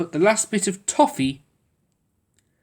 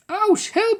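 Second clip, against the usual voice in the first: high pained yelp for help; cried out. A narrator's reading. Noddy trapped in the net, crying out. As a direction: call out after the crash. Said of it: "he cried".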